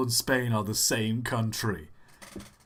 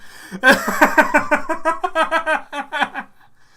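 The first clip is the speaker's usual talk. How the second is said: loud, high burst of laughter; big laugh; 11 dB louder than usual. Real, not acted. Streamer cracking up at a Redditor impression, laughing loudly.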